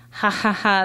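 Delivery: loud, jeering ha ha ha; mocking laughter. Kids in the lunchroom shouting mocking laughter. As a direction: laugh at her, loud and cruel. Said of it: "they all shout".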